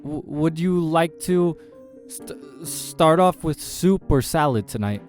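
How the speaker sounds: high, loud, slow stammered server line; stuttering. A narrator's reading. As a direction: struggle the rote question out. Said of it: "I struggle to stutter".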